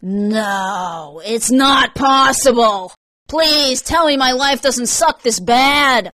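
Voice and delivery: loud, drawn-out, high wail of disbelief; dismayed outcry. Acted. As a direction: lament it slowly at full voice. Jaw drops in dismay seeing her.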